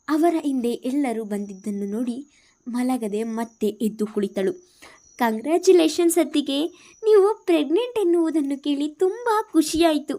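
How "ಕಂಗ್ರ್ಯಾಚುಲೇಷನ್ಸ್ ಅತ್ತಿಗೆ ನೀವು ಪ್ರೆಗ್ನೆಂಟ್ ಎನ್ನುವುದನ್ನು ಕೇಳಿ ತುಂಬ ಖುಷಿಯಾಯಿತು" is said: high, loud and delighted; excited congratulations. Acted. Sister-in-law hugging her, excited high congratulations. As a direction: burst in with joyful congratulations.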